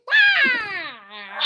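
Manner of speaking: loud high comic wail; a comedian's trademark squeal in a radio sketch; Costello wail. Acted. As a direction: let out a goofy high wail.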